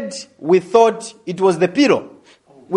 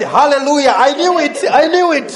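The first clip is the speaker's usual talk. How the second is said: shouting praise as the news sinks in; triumphant praise; 8 semitones higher, 4 dB louder than usual. Retold or imitated. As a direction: let the joy burst out. Triumphant praise after good news.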